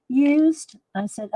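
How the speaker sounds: ordinary delivery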